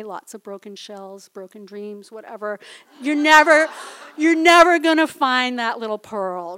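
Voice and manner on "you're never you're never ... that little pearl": loud, anguished exclaim; loud dismay. Real, not acted. Pearl lost through the floor gaps, loud dismay. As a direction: blurt out the loss loudly.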